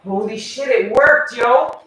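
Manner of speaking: triumphant outburst over the trapped bug; excited shout. Real, not acted. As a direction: shout with real disbelief and glee.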